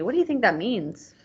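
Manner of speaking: ordinary talk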